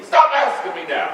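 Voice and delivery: loud, high, angry command. Acted. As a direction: cut him off angrily. Father ends heated questioning.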